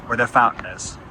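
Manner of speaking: usual speaking voice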